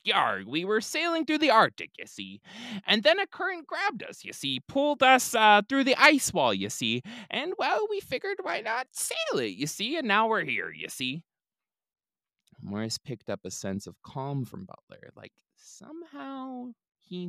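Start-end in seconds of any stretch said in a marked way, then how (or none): 0.1-11.2 pirate captain. Acted. Captain explains himself, pirate voice.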